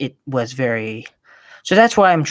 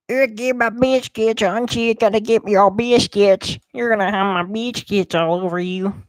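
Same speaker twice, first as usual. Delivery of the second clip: high silly character voice; lightly voiced goofy game figure; 7 semitones higher than usual. Gamer voicing a character about biscuits, high goofy voice.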